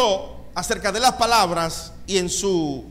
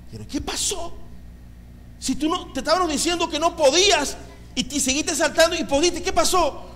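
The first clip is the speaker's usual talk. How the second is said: high, incredulous questioning; pitched-up voice of amazed disbelief; acted astonishment; 9 semitones higher than usual. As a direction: play the baffled onlookers demanding an explanation. Frogs question the escaped frog.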